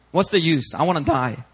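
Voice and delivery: plain reading voice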